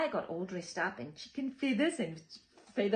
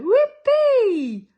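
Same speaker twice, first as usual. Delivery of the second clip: high, loud whoop; cheering; 13 semitones higher, 17 dB louder than usual. Acted. Stranger cheering the chooks, a high loud whoop. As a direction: cheer like a thrilled fan. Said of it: "cheers the stranger".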